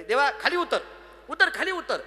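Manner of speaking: high, insistent call to God. Acted. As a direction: summon him down familiarly. Devotee calls God down from pedestal.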